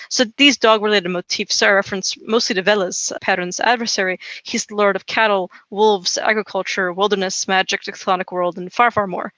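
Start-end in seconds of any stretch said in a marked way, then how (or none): none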